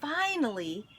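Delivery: plain reading voice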